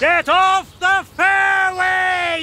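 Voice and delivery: furious golfer's shout; angry shout. Acted. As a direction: bellow at the trespasser. Golfer yelling at intruder.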